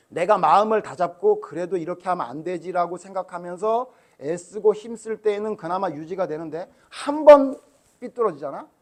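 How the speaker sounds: usual speaking voice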